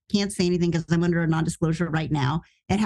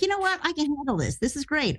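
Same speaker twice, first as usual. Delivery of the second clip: mock upbeat; 5 semitones higher, faster than usual. Retold confident self-talk.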